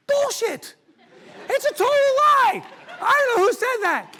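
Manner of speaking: loud, high, furious outburst; outraged shout. Real, not acted. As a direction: blast the cliché away. Explodes rejecting the saying.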